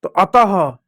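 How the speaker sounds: ordinary talk, nothing marked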